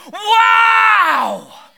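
a huge, high, loud wow; awestruck wow. Retold or imitated. Creatures around the throne crying out in awe. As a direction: gasp it out in amazement. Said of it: "the creatures around the throne are just going".

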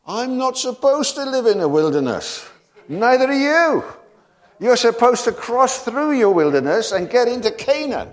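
high, loud fiery preaching; preaching at full force. Acted. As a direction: thunder it out to the congregation. Preacher exhorting the congregation.

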